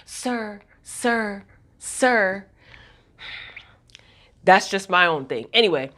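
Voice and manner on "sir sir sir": drawn-out, separate mock-deferential sirs; lightly mock her way of saying sir; parroting a deferential employee; mocking imitation. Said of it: "I don't know why that irks me so much".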